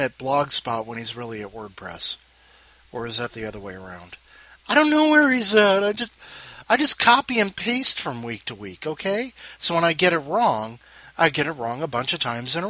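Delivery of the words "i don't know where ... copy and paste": much higher and louder; high exasperated. Real, not acted. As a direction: throw up your hands, high and loud.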